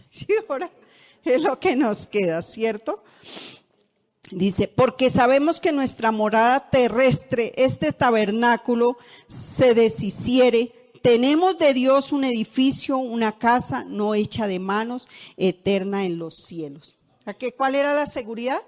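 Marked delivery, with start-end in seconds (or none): 0.0-0.7 said through a laugh, pitched up; amused laughing reply. Real, not acted. Laughing reply to prayer suggestion. 4.7-17.0 solemn loud Bible reading; scripture reading. Acted. Reads scripture aloud.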